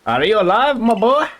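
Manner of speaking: fussy professor. Acted. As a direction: fuss over the boy like a dotty professor.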